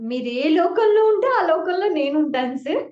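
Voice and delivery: loud, earnest vow to her teacher; declaring devotion loudly to sir; fervent plea. Retold or imitated. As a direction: pour it out as a loud promise. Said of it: "మళ్ళా అంత positive గానే మాట్లాడాలి".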